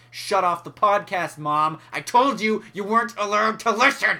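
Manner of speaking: loud, rising indignation; mock scolding. Podcaster addressing his mom listening in, mock angry scolding. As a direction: build to a hammed-up telling-off.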